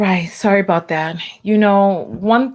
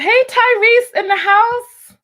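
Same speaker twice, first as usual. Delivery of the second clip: high, loud, drawn-out hype greeting; excited shout-out; 13 semitones higher, 5 dB louder, slower than usual. Real, not acted. Streamer hyping a viewer arriving in chat, high and loud. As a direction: call the viewer's name out like he just walked in.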